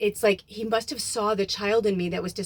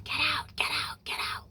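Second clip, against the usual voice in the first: eerie whisper; 7 semitones higher, 6 dB quieter than usual. Retold or imitated. Voicing dark shadows.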